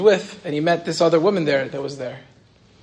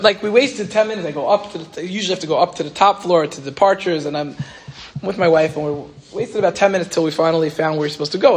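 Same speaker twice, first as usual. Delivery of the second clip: ordinary talk, nothing marked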